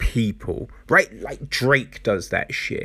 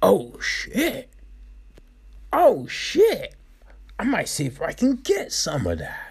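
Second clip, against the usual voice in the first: high-pitched mock realisation; mock opportunist; 6 semitones higher than usual. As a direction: imitate someone suddenly spotting easy money. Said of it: "then someone's like".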